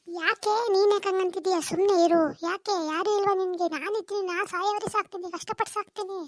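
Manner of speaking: a mother soothing her crying daughter; mother comforting. Acted.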